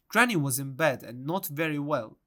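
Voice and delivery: ordinary delivery, nothing marked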